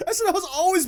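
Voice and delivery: talking through a laugh. Real, not acted. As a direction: laugh while you say it. Laughing while disagreeing.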